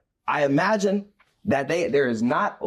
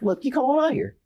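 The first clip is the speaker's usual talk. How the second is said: inviting call; 11 semitones higher, faster than usual. Retold or imitated. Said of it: "Jesus is like".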